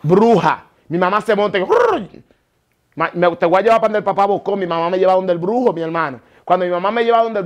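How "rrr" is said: possessed growl. Retold or imitated.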